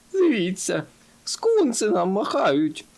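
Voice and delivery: high-pitched, drawn-out happy call; excited cry. A narrator's reading. Dumdidum pointing out the waving skunks to his friends, crying out. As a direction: call out high, stretching the words. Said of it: "крикнув він".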